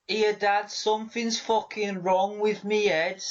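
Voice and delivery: high-pitched mock Scouse accent; badly faked Brummie that slides into Scouse; attempted Brummie, sounding Scouse. Child telling Dad about a problem, bad Scouse-sounding accent. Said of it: "That's Scouse".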